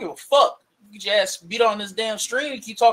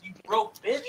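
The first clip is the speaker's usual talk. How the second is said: usual speaking voice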